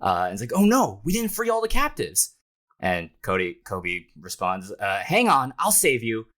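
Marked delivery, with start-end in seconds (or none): 0.5-2.3 very high, dismayed character voice; hammed-up distressed realisation; acted alarm. Kobe realises captives remain. 5.1-6.2 raised, higher rescuer's voice; mock-heroic shout of reassurance; acted heroic call. Kobe calls to kidnapped woman.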